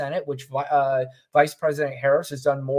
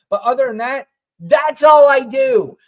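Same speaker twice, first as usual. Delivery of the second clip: mock shout; 7 semitones higher, 8 dB louder than usual. Mock vice president quote ending.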